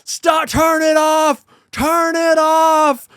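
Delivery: high, drawn-out mock yell; hammed-up pleading cry, stretched long; imitated desperate yelling. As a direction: wail it like a desperate movie hero. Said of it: "it ends with that iconic".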